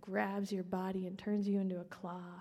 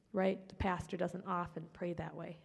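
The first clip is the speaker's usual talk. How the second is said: ordinary delivery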